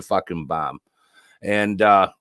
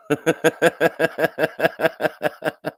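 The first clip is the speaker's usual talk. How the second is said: hearty laugh; 8 semitones higher than usual. Real, not acted. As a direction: let out a big belly laugh. Big laugh after the joke.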